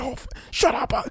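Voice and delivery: fake-sob a tantrum; hammed-up crying meltdown; mocking meltdown. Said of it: "he's crashing out like".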